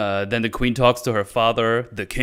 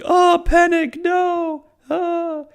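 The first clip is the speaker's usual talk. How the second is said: much higher, louder squeaky panic; mock panic; 4 dB louder, much slower than usual. Nori panicking as her hand freezes, mock shrill. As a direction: squeal it like a cartoon fright. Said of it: "And she's like".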